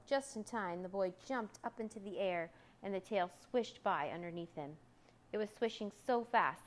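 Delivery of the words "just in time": ordinary delivery, nothing marked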